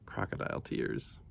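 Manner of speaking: soft whispered aside. A narrator's reading. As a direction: drop to a whisper for the joke. Wry aside after narration.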